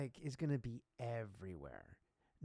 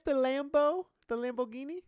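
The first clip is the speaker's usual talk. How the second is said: much higher, louder mock girlfriend; teasing girlfriend voice; 10 semitones higher, 8 dB louder than usual. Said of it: "Hey, Frankie, you wanna get".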